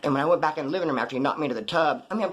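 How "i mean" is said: plain reading voice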